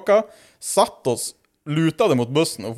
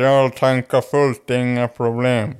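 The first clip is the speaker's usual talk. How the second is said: slow, stretched mock drunk promise; drawn-out drunk assurance; slower than usual. Drunk man promises kids fuel, slow drawl. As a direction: stretch it out with tipsy confidence.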